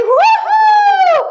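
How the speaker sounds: high, loud cheering whoop; excited whoop. Real, not acted. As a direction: whoop like it's a party. Cheering the class on.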